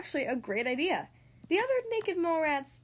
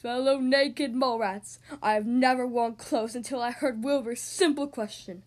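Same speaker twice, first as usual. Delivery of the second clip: booming warrior king; 3 dB louder than usual. Acted. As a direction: address the colony like a king. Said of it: "cleared his throat and proclaimed".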